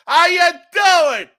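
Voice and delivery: high, loud drawn-out "How you doing?"; mob-style greeting. Pretend. Host greeting the Giants quarterback, loud wiseguy shout. Greeting Tommy DeVito, Sopranos joke.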